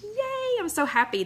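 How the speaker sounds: high, delighted squeal of yay; thrilled high-pitched cheer; excited yay. Real, not acted. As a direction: cheer it like happy news. Viewer loved her recipe.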